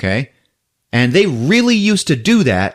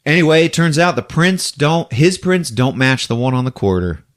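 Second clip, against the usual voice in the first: usual speaking voice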